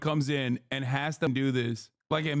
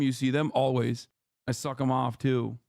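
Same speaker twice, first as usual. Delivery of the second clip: usual speaking voice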